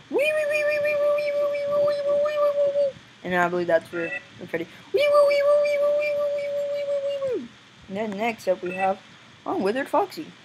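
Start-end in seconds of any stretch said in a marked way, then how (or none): 0.1-2.9 very high repeated "wee" squeal; imitated squeal. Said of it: "You're like". 4.9-7.5 very high wee-woo siren; imitated siren. Imitates animatronic siren noise.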